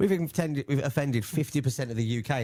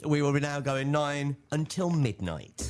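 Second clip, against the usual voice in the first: higher, slow grand announcement; dramatic announcer; 5 semitones higher, slower than usual. Acted. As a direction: drag it out like a big reveal.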